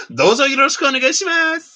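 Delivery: mock high-voiced self-introduction; high girly voice. Shown as a demonstration. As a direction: pitch the Japanese way up like a girl would say it. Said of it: "not like".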